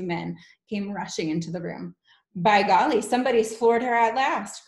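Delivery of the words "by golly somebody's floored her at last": loud, gleeful exclamation; gleeful cry. Acted. Teacher delighted the Trunchbull is down, gleeful cry. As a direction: cry out with a grin. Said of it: "cried one of the men, grinning".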